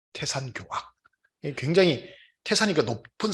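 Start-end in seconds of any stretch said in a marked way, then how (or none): 0.1-0.9 태산교악 breathed out unvoiced, much softer; soft whispered repeat. Lecturer echoing the old saying to the audience, whispered. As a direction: let the word fall to a whisper.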